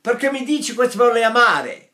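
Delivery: put-on northern Italian accent. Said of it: "If you go up north".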